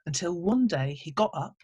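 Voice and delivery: usual speaking voice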